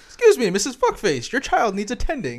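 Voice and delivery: high, loud robot butler voice; imitating the game's robot. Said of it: "the robot goes".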